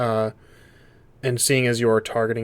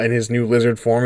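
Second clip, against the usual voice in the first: ordinary delivery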